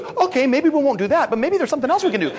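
high, eager cartoon dog bouncing back; little rat dog. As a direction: placate the boss in a high little voice. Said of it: "the little dog comes back".

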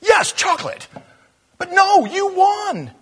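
loud, very high emotional cry; tearful, high-pitched exclamation; overcome, near tears. Real, not acted. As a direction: squeal it out, overwhelmed by the moment. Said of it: "I wanna cry now".

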